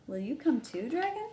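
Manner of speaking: rising high on Dragon; a bright bunny question; Rabbit asking. Acted. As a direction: Rabbit inviting Dragon along. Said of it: "asked Rabbit".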